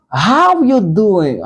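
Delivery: "How you doing?" said slowly and clearly for learners; slow model phrase. Shown as a demonstration. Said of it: "Ki vle di kòman ye?". (fraz "How you doing?" dousman, byen klè)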